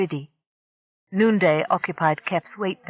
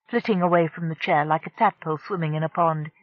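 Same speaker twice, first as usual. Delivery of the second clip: plain reading voice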